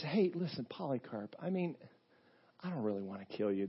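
hushed plea. Retold or imitated. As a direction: lean in and urge him quietly. Sheriff pleading quietly with Polycarp.

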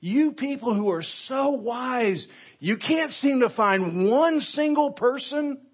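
mock admiration, pitched high; sarcastic rebuke. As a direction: heap on false praise with a sting. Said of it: "Very sarcastic, very stinging rebuke by Paul".

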